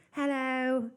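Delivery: high, sing-song "Hello."; mock high greeting. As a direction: chirp it brightly like a cheery newcomer. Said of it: "she's like".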